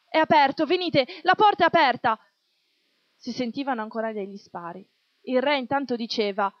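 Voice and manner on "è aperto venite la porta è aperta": loud, higher call across the crowd. A narrator's reading. A voice in the crowd urging everyone inside, loud call. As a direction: shout to the people to come in. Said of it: "una voce disse".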